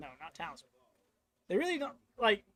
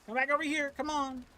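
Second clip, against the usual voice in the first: taunting; 8 semitones higher than usual. Pretend. Taunting the boss in voice.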